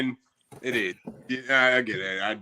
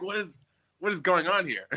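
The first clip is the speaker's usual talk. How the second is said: surprised; 9 semitones higher than usual. Retold or imitated. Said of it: "And I'm like".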